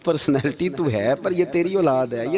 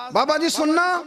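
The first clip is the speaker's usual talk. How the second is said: put-on shouted child's call, much higher; 12 semitones higher than usual. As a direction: call out to the old man like a child. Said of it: "آواز دی".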